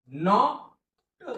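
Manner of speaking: teacher stretching नॉट to show its sounds; drawn-out word. Shown as a demonstration. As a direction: stretch नॉट slowly, sounding each part. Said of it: "संपला शब्द".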